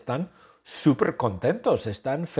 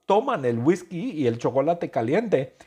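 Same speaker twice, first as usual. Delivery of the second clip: usual speaking voice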